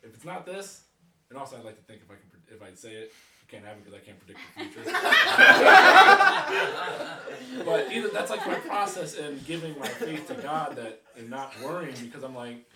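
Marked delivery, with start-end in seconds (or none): 5.0-7.6 a big burst of real laughter; hearty cracking-up laugh; loud laugh. Real, not acted.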